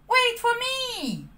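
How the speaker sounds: high, slow call from a tiny cricket. Acted. Jiminy chasing Pinocchio down the street, calling high. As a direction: stretch it out like a small cricket trailing behind. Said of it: "he called".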